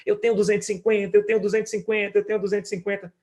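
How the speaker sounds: put-on high, gleeful chant of a little boy; imitating an excited child. Father imitates his son shouting with joy over savings. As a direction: bounce through it like a kid jumping for joy. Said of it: "começou a pular e gritar".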